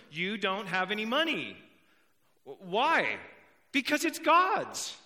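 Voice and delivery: builds to a loud, high "Because it's God's"; rising emphatic declaration. Acted. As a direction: proclaim it, climbing to a shout. Sermon climax, rising emphasis.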